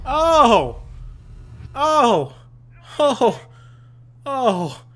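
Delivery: loud, high repeated "oh"; excited exclamations. Real, not acted. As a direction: cry out at each hit, easing off. Excited by action in game.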